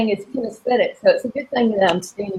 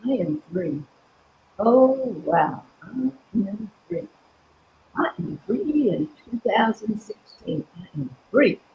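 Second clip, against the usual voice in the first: soft affirmation; 7 dB quieter than usual. Shown as a demonstration. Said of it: "you merely cross your arms over your chest and you just say".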